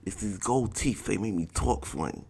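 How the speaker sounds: imitating the teammate explaining his gold teeth; gold-teeth guy voice. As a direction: own it like a joke. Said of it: "Buddy's like".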